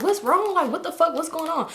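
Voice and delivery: high-pitched, panicked questioning; imitating startled alarm. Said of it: "He hop up quick".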